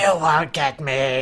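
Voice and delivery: slow, a hint of a creepy alien; lightly voiced sinister alien; scorpion voice. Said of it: "switches to what is apparently a Scorpion voice".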